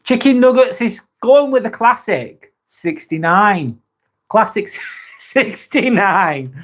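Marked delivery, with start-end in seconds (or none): 4.3-6.5 drawn-out, higher, laugh breaking in; naughty giggle mid-phrase; cheeky laughing. Real, not acted. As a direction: chuckle through it slowly, pitched up. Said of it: "I'm being a bit cheeky there".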